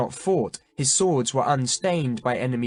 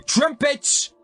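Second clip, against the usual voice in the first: very high furious yell; king furiously calling for the trumpets; angry shout; 5 dB louder than usual. A narrator's reading. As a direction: bellow the order in rage. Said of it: "the king shouted, still angrily".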